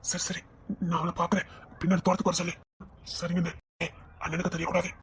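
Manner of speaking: softly plotting so the elder brother won't hear; hushed secret talk. Acted. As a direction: keep it secret, barely above a breath. Secret talk, brother must not know.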